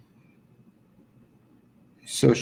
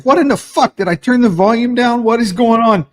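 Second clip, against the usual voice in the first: much higher, baffled cursing; exasperated outburst; 10 semitones higher, 4 dB louder than usual. Retold or imitated. Caught off guard by a muted video, exasperated. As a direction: blow up at the phone in mock fury. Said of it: "I'm getting pissed off at my phone".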